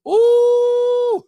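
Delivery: high, loud, slow hype; thrilled cheer. Real, not acted. Streamer hyping chat after a rap, loud and high. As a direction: cheer them on loudly.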